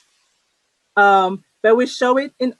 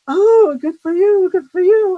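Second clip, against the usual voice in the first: mock breezy reply; 8 semitones higher, 5 dB louder than usual. Immigrant mimicking Americans brushing off her complaint, hammed-up chirpy.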